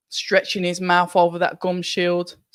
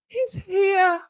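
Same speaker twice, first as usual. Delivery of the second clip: sharp gasp, then much higher; gasping surprise. Real, not acted. Streamer spots the fighter on screen, gasps high and surprised. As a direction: gasp as he walks in.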